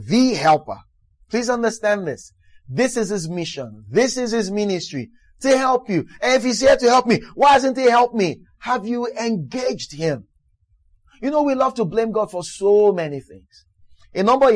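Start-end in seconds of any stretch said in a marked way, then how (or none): 6.2-8.4 loud, high, fast complaint; mock-indignant cry to heaven; indignant shout. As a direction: shout it out as an aggrieved believer. Voicing the complaining believer.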